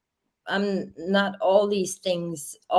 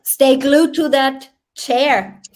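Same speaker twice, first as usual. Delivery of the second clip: loud, slow, higher mock order; teasing command; 6 semitones higher, 7 dB louder, slower than usual. Real, not acted. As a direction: boss him around playfully. Teasing command during call.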